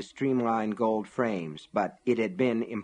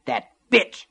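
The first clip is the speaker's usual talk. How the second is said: loud, higher, spitting fury; spat in anger; 5 semitones higher, 5 dB louder than usual. A narrator's reading. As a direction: spit the words out with contempt. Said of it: "Cora spat".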